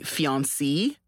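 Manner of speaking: fiancé ending in a long "see". Shown as a demonstration. Explaining the female noun to the host, fiancé ending in "see". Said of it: "saying it wrong".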